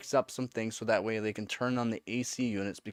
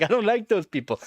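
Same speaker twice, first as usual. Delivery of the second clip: high, loud whiny complaint; mock pouty whine; 11 semitones higher, 8 dB louder than usual. His heart complaining about those people, loud mock whine. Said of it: "Heart's like".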